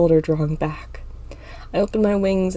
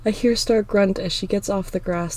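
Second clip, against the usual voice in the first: ordinary delivery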